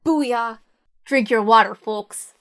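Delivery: ordinary talk, nothing marked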